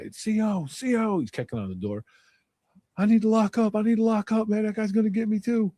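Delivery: mock a scared man begging to be locked up; whiny panicked inmate. Stabbed inmate kicking door calling CO, high panicked whine. Beaten guy calling for guards.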